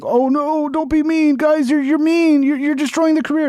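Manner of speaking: very high, pleading mock dismay; mock distressed. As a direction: imitate an oversensitive critic begging them to stop. Mocking critics of mean judging.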